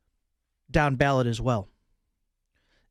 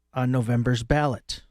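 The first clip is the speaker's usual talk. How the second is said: ordinary talk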